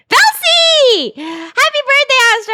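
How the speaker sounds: high, loud slow birthday call; excited greeting. Real, not acted. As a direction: shout the birthday wish joyfully.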